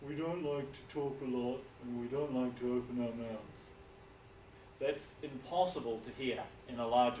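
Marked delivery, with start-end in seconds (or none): 0.1-3.7 low, mouth barely open, New Zealand style; lazy Kiwi speech with the mouth kept shut; closed-mouth New Zealand mumble. Shown as a demonstration. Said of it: "New Zealanders like to speak with their mouth like this".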